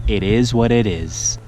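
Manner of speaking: slow, drawn-out put-on voice. Shown as a demonstration. Angler repeats his motto slowly in a put-on voice. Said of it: "repeated take".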